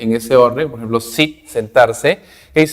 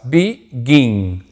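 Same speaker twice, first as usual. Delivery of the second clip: syllable by syllable. Shown as a demonstration. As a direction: BEGIN split into two drawn-out syllables, be, gin. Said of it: "Begin tiene dos sílabas".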